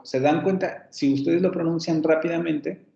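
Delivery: ordinary talk, nothing marked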